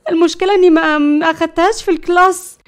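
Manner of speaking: high, loud flustered panic; fearful. A narrator's reading. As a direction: admit it in rising alarm. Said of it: "ليلى قالت بخوف".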